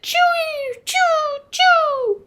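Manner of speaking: very high sliding chirps; purple martin call. A narrator's reading. Purple Martin keeping Owl awake, high drawn-out chirping. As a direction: stretch each high chirp like a bird. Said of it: "Purple Martin said".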